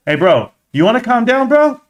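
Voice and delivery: high, rising pet-talk voice; talking to the cat. Real, not acted. Streamer scolding his cat for playing with the bag, high pet-talk voice. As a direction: coax the cat up high, bro to bro.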